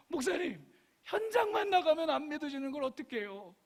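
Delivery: high-pitched mock-pleading voice; exaggerated high-voiced congregant's complaint; complaining congregant. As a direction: whine it helplessly to the pastor. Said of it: "막 그래요".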